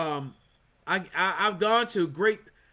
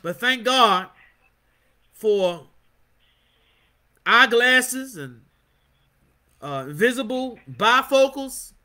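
slow drawn-out punchline; 4 dB louder, slower than usual. Real, not acted.

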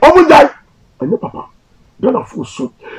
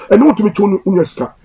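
normal talking voice

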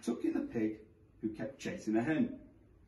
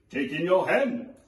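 put-on wise old sage; 4 semitones higher, 7 dB louder than usual. A narrator's reading. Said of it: "said the wise old man".

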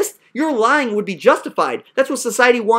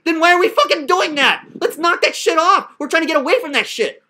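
higher-pitched, fed-up outburst; exasperated scolding; 5 semitones higher than usual. Real, not acted. Teacher fed up with student's act, exasperated scolding.

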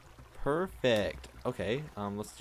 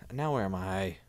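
normal talking voice

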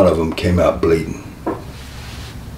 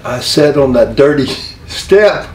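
usual speaking voice

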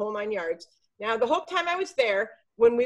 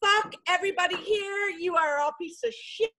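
retold screaming rage; 7 semitones higher than usual. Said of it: "I'm screaming, yelling profanities".

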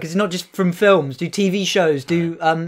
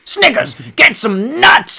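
Mister T impression; 5 semitones higher, slower than usual. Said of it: "Mister T".

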